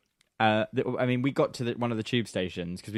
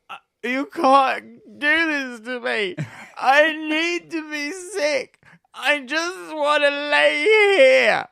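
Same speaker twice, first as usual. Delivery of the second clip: high, loud, drawn-out sobbing whine; mock drunken wailing; 7 dB louder, much slower than usual. Housemate imitating drunk friend begging to lie in puddles, mock crying. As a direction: bawl slowly like a pathetic drunk.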